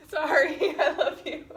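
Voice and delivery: high, loud, giggly sorry; laughing apology. Real, not acted. Wife laughing her apology to husband: giggling.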